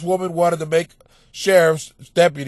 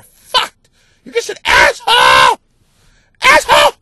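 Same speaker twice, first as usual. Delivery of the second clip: much higher, loud cracking scream of "asshole"; mock angry yell; 7 dB louder than usual. Host imitating an angry listener, mock screamed insult. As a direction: shriek the insult like a furious listener.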